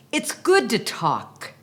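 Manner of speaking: expressive poem read over gentle piano; poem recital. Acted. Reading the poem to children, lively and expressive. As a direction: lift each line like a song to children.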